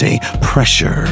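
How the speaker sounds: ordinary delivery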